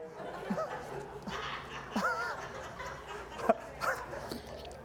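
breathless silent laughter rising; long high wheezing laugh. Real, not acted. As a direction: crack up helplessly. Laughs at own story.

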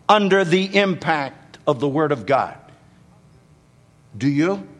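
ordinary talk, nothing marked

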